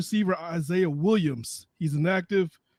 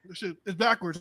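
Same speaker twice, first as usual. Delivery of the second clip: usual speaking voice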